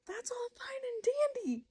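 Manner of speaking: very high, airy exasperation; exasperated. Real, not acted. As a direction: squeak it out in near-whispered exasperation. Exasperated by badly timed mate revelation.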